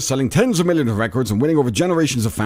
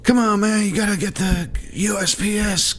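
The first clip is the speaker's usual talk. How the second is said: high, breathy Biden voice; mock Biden, pitched way up; hammed-up old-man Biden; Biden impression; 10 semitones higher than usual. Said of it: "Biden's talking about".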